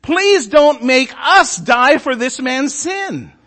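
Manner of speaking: slow, drawn-out begging; pleading. Acted. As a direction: sailors beg the Lord slowly. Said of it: "they pleaded".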